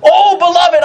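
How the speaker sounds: usual speaking voice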